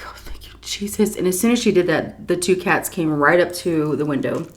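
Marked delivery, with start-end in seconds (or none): 0.1-1.1 soft whispered relief; whispered thanks. Retold or imitated. Caregiver relieved, whispering thanks to Jesus. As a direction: breathe out thanks.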